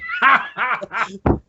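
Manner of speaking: imitated high laughter; Mike's laugh. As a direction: act out someone else cracking up. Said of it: "and Mike's like".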